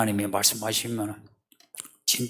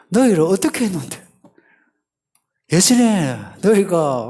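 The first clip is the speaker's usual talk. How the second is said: loud, high-pitched rebuke as God; indignant reproach; 8 semitones higher, 7 dB louder than usual. Acted. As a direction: reproach them loudly, voice raised high. Acting God's reproach to Israel.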